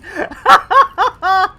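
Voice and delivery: high, loud peal of laughter; gleeful laugh. Real, not acted. Streamer amused at naming their community, gleeful laugh. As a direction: burst into real laughter.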